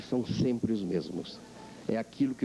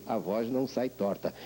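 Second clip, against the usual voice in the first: normal talking voice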